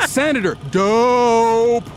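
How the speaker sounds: hammed-up disbelief at the senator; mock exasperation. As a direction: drug chief pleading with the senator. Anslinger pleads mockingly.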